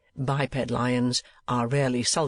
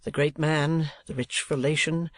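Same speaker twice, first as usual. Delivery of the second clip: usual speaking voice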